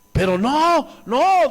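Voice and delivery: high, insistent mock refusal; protesting relatives. Relatives refuse to accept God's will, imitated insistent protest. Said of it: "dicen los familiares".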